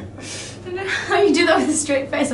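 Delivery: words breaking into giggles; laughing. Real, not acted. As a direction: talk through real laughter. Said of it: "I was trying not to laugh".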